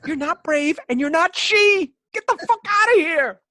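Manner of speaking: loud, high-pitched outraged yelling; mock outrage. Podcaster rejecting his own brave act, high mock-outraged shouting. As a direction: build to a comic shout, very high.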